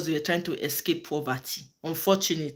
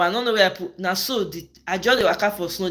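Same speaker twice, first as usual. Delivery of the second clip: usual speaking voice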